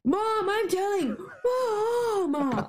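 slow high drawn-out tattle; whiny kid. Retold or imitated. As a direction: stretch it out like a sulky child. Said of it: "a very good Canadian accent".

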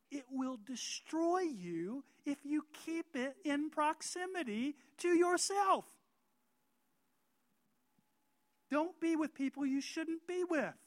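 full-force pulpit preaching. Acted. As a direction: drive the warning home from the pulpit. Preaching builds to a peak.

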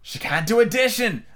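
high, drawn-out dismissal; interrupting queen. A narrator's reading. Red Queen interrupting Alice's sum, dismissive. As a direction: cut in as a haughty high-voiced queen. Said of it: "the Red Queen interrupted".

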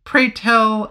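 mock indignant nobleman; Anthony voice. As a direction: slip into Anthony. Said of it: "oh, sorry, that's me".

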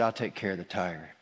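put-on Jesus reassuring a scared three-year-old, gently; gentle Jesus. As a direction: speak like a tender father calming a child. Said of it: "He said".

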